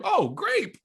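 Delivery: very high-pitched fake shriek of discovery; mock scream. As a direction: squeal it like a sudden revelation. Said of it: "wanted him to just scream".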